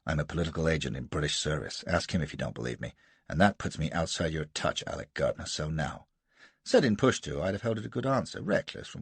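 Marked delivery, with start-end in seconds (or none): 0.0-6.1 put-on low, blunt American accent. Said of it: "with an accent even more American".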